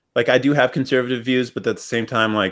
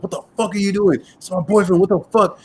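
higher, imitating an angry girlfriend; 9 semitones higher than usual. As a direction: mimic her outraged yell at the police. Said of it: "She's like".

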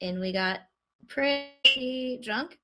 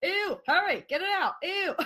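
high, squealing disgust; freaking out; 9 semitones higher than usual. Retold or imitated. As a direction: squeal in grossed-out panic. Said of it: "I'm freaking out".

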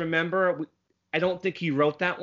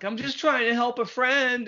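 put-on little girl voice; 8 semitones higher than usual. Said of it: "Goslin's like".

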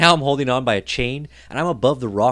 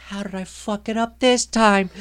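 free-fall dismay; 10 semitones higher than usual. Retold or imitated. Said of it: "and I'm like".

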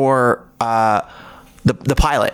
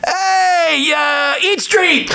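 much higher mock shout, slow and laughing; fan shouting across the street; 13 semitones higher, much slower than usual. Stranger across the street, high laughing mock shout. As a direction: yell the wrong show name across traffic. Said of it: "somebody will be screaming".

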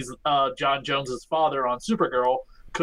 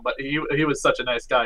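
ordinary talk

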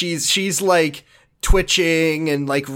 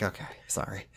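whispered; 9 semitones lower, 18 dB quieter, faster than usual. Retold or imitated. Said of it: "she's like".

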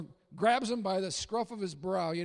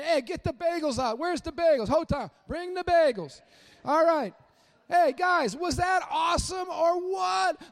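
higher and louder, calling for bagels with glee; joyful host Jesus; 7 semitones higher, 5 dB louder than usual. Retold or imitated. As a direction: throw a happy party for the disciples. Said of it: "It's Jesus saying".

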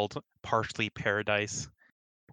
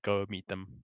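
ordinary talk

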